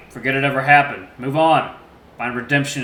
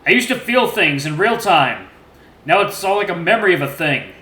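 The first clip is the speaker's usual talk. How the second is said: broken confession; 5 semitones higher, 8 dB louder than usual. A narrator's reading. Numb father confesses before gun.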